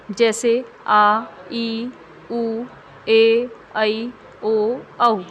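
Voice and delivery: आ, ई, उ, ए, ऐ, ओ, औ each held long; long vowels drawn out. Shown as a demonstration. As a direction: stretch every vowel like आ, teacher demo. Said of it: "दुगना समय लगता है".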